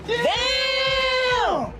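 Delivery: high, loud shocked yell; surprised shriek. Real, not acted.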